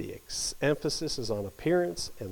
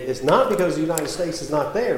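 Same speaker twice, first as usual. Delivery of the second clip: ordinary talk, nothing marked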